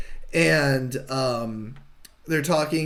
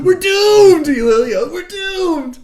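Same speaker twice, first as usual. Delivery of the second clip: high, very loud hammed-up panic; screaming Hudson; 9 dB louder, much slower than usual. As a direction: wail it like a soldier falling apart. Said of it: "Hudson is just screaming constantly".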